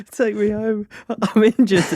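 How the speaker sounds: high-pitched mock whine of a hurt mate; hammed-up whimper from a mate with a fat lip; whiny injured mate. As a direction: plead like a sulking injured friend to go home. Injured mate whining to be taken home.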